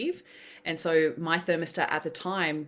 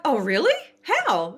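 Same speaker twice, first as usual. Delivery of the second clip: louder, higher, startled "really"; surprised; 8 semitones higher, 7 dB louder, slower than usual. Retold or imitated. To friend with lottery news, loud surprise. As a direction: pop the question out loud. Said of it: "I'm like".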